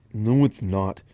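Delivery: ordinary delivery, nothing marked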